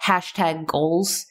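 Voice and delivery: ordinary talk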